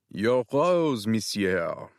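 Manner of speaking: hammed-up bad French accent; terrible French accent. Matt handing Tanner a rose, terrible French accent. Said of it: "I said in a terrible French accent".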